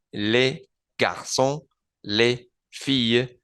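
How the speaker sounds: les said like Spanish E, silent final S, slow; les étudiants with a Z linking; slow plural demo. Shown as a demonstration. Teacher slowly modelling French plurals. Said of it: "los y las se dice igual en francés".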